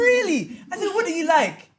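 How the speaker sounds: high, loud mock disbelief; incredulous challenge. Woman questioning her date's confession that he likes her, loudly incredulous. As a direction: challenge it loudly, pitch shooting up.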